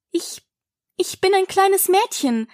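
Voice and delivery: put-on hesitant, high child voice; somewhat unsure. A narrator's reading. Alice answers the pigeon, unsure. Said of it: "sagte Alice etwas unsicher".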